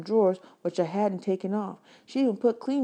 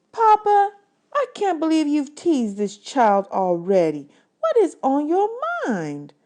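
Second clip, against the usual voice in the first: much higher, louder, slow exasperated scold; scolding Papa; 10 semitones higher, 7 dB louder, slower than usual. Acted. Daughter to Papa for teasing the boy, loud exasperated scold. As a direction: tell your father off in front of the child.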